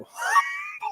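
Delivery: can't hold back the laugh. Real, not acted. Laughing mid-story.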